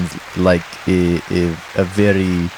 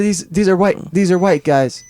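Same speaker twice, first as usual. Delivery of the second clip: high startled exclamation; shocked high-pitched realisation; surprised; 10 semitones higher than usual. Real, not acted. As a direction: blurt it out in alarm. Surprised reaction, voice jumps high.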